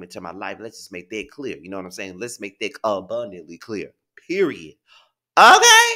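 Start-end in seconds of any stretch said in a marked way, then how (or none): 5.4-5.9 sharp high squeal of okay; emphatic okay. Real, not acted. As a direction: punch it up high and loud. Emphatic tag after making point.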